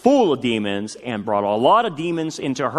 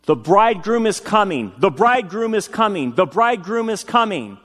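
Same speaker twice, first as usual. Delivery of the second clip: higher-pitched urgent retold cry, repeated; retold yelling; 6 semitones higher than usual. Husband waking from a night's sleep, retold yelling. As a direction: call it out high, like someone yelling in his sleep. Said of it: "and kept yelling".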